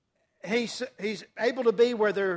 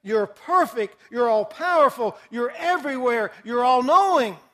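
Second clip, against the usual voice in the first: proclaiming loudly, like the beasts worshipping; loud praise; 3 semitones higher, 7 dB louder than usual. Acted. Beasts before the throne praising God: loud, building acclamation. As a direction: call it out in praise, building louder. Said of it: "continually say".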